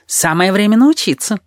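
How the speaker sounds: high-pitched, pushy character voice; high, insistent encouragement; eager retort. A narrator's reading. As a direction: pipe up with a quick comeback. Tabaki's eager retort to the protest.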